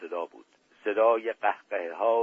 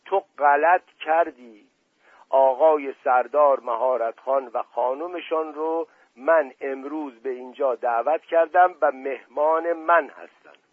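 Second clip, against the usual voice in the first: angry shout; 4 semitones higher, 6 dB louder than usual. Acted. Dust Ali Khan yelling at the prince in front of guests. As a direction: shout it at him in a childish rage. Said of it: "فریاد زد".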